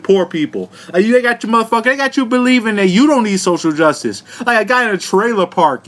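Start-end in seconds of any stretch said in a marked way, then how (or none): none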